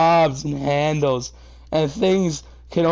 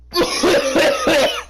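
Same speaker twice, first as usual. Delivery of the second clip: cringe cough; 5 dB louder than usual. Real, not acted. Choking on the sex line.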